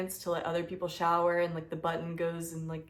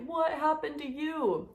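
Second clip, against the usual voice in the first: high, drawn-out disbelief; aghast question; 7 semitones higher, slower than usual. Retold or imitated. Pilgrims asking about her blistered feet, aghast. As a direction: imitate an aghast onlooker, high and slow.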